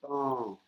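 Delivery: "tong" pitched high and falling; "tong" with a high Thai tone. Shown as a demonstration. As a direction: say "tong" high, then fall. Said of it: "avec une accentuation aiguë".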